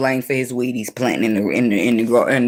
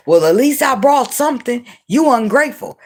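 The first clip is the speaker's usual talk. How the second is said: higher, huffy mock indignation; lightly voiced huffy excuse; mock indignant; 9 semitones higher than usual. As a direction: imitate a defensive excuse-maker. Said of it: "this is what they'll say".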